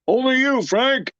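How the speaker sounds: high called-down line, mock shout; retold shout. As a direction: call it down from above. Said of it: "And the cameraman shouts down".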